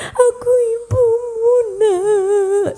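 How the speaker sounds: high, slow, sobbing plea; weeping plea. Acted. Mother at her son's feet, weeping. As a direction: beg on your knees, crying. Mother kneels, pleading again.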